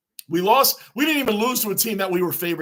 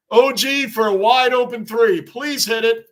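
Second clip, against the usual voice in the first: louder and higher, urging the shot in; hopeful shout; 5 semitones higher, 7 dB louder than usual. Real, not acted. Fan urging his player to hit the shot, loud hopeful call. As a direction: yell at the screen for the open three. Cheering a shot while watching.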